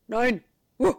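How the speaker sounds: loud gruff terrier; barking dog voice. A narrator's reading. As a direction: bark the words like a dog. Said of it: "bellt Charlie".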